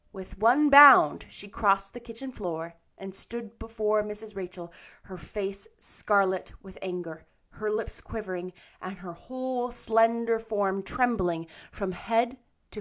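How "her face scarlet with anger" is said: plain reading voice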